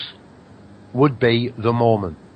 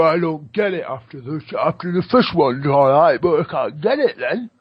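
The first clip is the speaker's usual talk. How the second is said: confused viewer; 3 semitones higher than usual. Retold or imitated. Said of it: "A lot of people".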